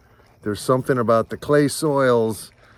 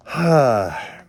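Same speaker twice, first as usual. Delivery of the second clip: heavy sigh; 5 dB louder than usual. Real, not acted. Sigh of sadness.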